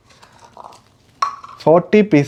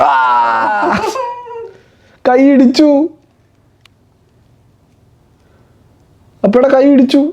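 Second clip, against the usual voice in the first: mock hurt; 9 semitones higher than usual. Father playfully complains to toddler.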